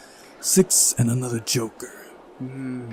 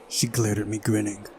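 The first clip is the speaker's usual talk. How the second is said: ordinary talk, nothing marked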